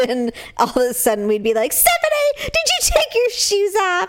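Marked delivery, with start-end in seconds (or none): 1.7-4.1 much higher, laughing accusation; mock accusing. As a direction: tease her about the smell. Siblings accusing in car.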